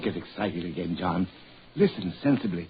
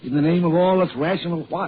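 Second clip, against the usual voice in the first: exasperated outburst; 7 semitones higher, 4 dB louder than usual. Acted. Doctor exasperated in argument.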